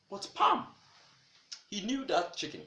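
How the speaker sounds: ordinary delivery, nothing marked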